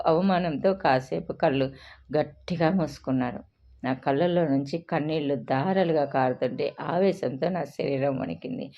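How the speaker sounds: usual speaking voice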